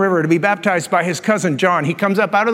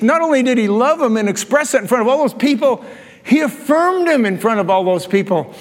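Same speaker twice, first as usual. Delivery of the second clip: ordinary talk, nothing marked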